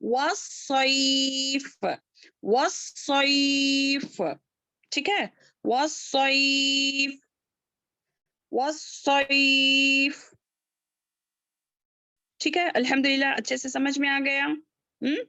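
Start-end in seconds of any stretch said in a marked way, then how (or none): 0.0-10.3 वस्सैफ slow, with a thick heavy स; heavy saad. Shown as a demonstration. Teacher models the heavy saad letter, slow. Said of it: "सौत को मोटा पढ़ेंगे".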